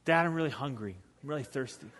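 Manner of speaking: lightly voiced hungry kid; soft, small plea to Dad; pleading son. Son asking dad for food at the game, soft pleading. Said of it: "got really sad".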